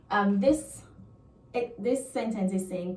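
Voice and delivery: ordinary talk, nothing marked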